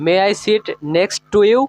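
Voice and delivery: each word spoken slowly and clearly for learners; teacher modelling a question slowly; slow, careful English. Shown as a demonstration. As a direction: lay out every word so students can copy it. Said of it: "এভাবে।".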